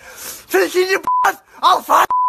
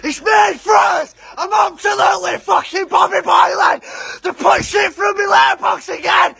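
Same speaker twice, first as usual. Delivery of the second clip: fuming shout. Acted. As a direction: shout out the whole street, hammed-up. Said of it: "I'm fuming".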